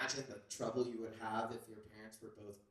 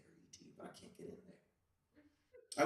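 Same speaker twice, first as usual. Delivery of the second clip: slow, voiceless, defeated whisper; broken whisper; 4 dB quieter, much slower than usual. Acted. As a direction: let it fall apart into a whisper. Elliot collapsing into despair.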